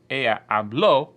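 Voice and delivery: habló with a loud, punched final syllable; stress on last syllable. Shown as a demonstration. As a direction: drive the weight onto the ó of habló. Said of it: "we're gonna emphasize and punctuate".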